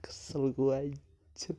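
breathy whisper, slow and annoyed; whispered aside. Real, not acted. Telling viewers how the call annoyed him, whispered. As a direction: mutter it under your breath, fed up.

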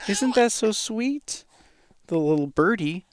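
very high, slow cooing question. Real, not acted. Cooing to baby.

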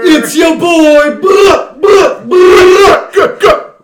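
loud, high silly yelling; shouting. Acted. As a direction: yell it like a goofy hype man. Rowdy intro shouting.

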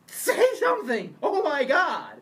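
high, loud frantic fan cry; fan yelling at the screen. Retold or imitated. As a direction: beg the character on screen to speak. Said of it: "any true Star Wars fan is like".

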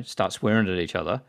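normal talking voice

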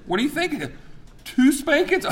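high-pitched incredulous dad; mock disbelief. Dad to son over his punishment, mock-incredulous. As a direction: pitch way up, hammed-up astonishment. Acted dad scoffs at son's guess.

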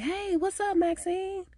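put-on mean girls faking sweetness toward Maxine; fake friendly greeting. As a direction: play the two-faced girls sucking up. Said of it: "looking on like".

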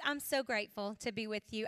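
ordinary talk

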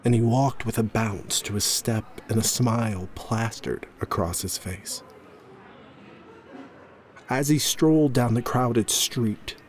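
ordinary delivery, nothing marked

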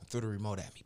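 usual speaking voice

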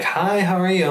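bright, high, sing-song hello from mom; mom's cheery greeting. Retold or imitated. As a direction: imitate mom's sweet greeting at the door. Mom greets her at door.

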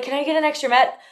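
high, small asking voice; hopeful young gymnast begging; pleading kid voice. Retold or imitated. Retold plea to coach.

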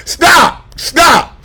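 loud, high cry of stop; full-force preaching yell; preaching shout. Real, not acted. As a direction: shout it out to the church.

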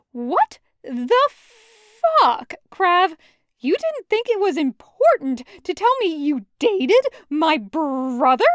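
loud, high, slow outraged yell; betrayed fury; furious. Acted. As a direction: hurl the accusation at him. Loop confronting Kravitz about brother.

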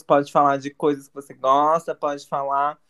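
usual speaking voice

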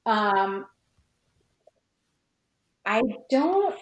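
usual speaking voice

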